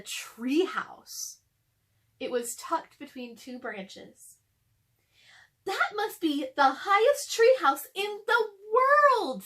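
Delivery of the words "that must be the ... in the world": put-on high, loud awed girl's voice; amazed Annie. A narrator's reading. Annie staring up at the treehouse, high amazed voice. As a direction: marvel at it out loud. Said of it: "said Annie".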